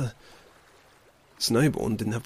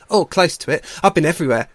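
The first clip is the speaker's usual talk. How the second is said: high, loud bluster; boastful otter; 5 semitones higher, 7 dB louder, faster than usual. Acted. Otter covers a fib to Leap, loud and boastful. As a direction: bluster through the backtrack.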